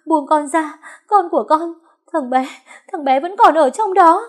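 high, anguished cry building to a scream; desperate pleading. Acted. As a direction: beg frantically to be let go. Mother fights to reach burning baby.

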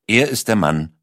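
usual speaking voice

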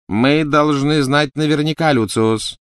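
high, loud, measured cry; cold, clear call. A narrator's reading. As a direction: call out coldly to a husband. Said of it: "крикнула она мужу холодным ясным голосом". (громко, холодным ясным голосом)

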